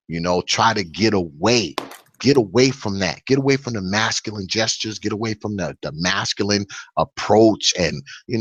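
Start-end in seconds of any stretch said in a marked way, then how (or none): none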